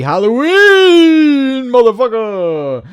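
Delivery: high, loud, stretched-out sign-off; exuberant holler. Real, not acted. Signing off on Halloween, exuberant real holler. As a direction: holler it, holding the vowels long.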